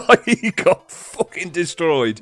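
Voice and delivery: laughing through the words; giggling, slow, high. Real, not acted. Laughing while telling the beating.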